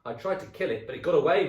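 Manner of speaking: loud put-on alarm; mock-alarmed old man. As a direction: scare her on purpose. Husband scaring wife.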